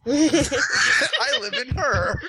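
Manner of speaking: laughing through the words, slow. Pretend. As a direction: giggle out a silly line. Joking, laughing.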